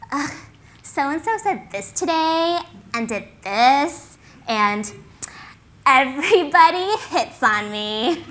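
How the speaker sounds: slow, loud, drawn-out mock whine; fake complaining. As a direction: imitate girls who secretly love what they complain about. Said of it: "you knew most of it was fake".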